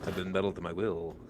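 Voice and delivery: low, very soft monotone villain. Acted. Magneto repeating his one line, low quiet voice. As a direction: murmur the catchphrase deep and deadpan. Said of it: "That's all he just keeps saying".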